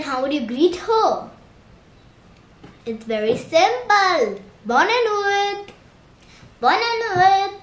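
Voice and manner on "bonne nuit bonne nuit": high, lilting, drawn-out farewell; sing-song good night. Shown as a demonstration. Quiz answer for greeting aunt at night, sing-song.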